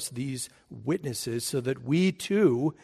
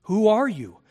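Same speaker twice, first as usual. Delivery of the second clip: louder, higher, insistent ask; the questioners insisting, repeating louder; pressing question; 5 semitones higher, 5 dB louder than usual. Retold or imitated. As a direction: demand who he is, pushing hard. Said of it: "They want to know".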